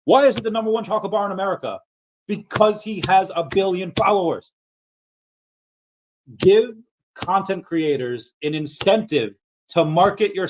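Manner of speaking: high, fast, insistent ranting; fired-up rant. Real, not acted. Streamer ranting to viewers about creator incentives, fired up. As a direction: push it like you're fed up with being ignored.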